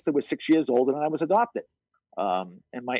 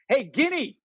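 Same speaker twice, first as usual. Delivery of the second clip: calling out; 10 semitones higher, slower than usual. Retold or imitated. Ball-breaking neighborhood calls.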